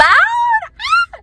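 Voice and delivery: high, loud thrilled squeal; a burst of high excited yelling; excited scream. Real, not acted. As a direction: let out a sudden delighted shriek. Recalling flying through a cloud.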